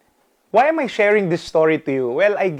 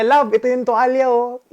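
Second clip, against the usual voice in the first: teasing sweet husband; 6 semitones higher than usual. Retold or imitated. Sweetly handing wife the towel.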